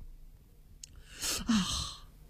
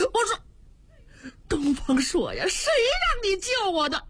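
furious stamping; 9 semitones higher, slower than usual. Acted. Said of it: "赵婉爬起来了，咬着牙，急得直跺脚".

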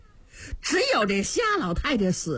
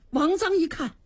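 plain reading voice

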